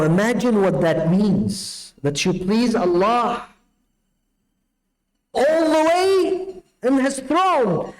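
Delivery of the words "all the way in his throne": rising sermon peak. Acted.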